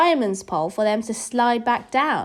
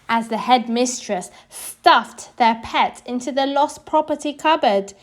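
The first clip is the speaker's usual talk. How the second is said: plain reading voice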